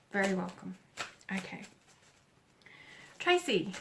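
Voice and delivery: usual speaking voice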